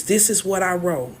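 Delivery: usual speaking voice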